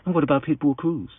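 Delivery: high, quick mock backpedal; suddenly changed tone. Father sidestepping the promoter's challenge, mock-timid and high. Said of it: "his whole tone changed immediately".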